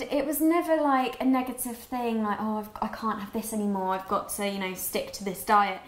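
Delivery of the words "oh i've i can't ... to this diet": usual speaking voice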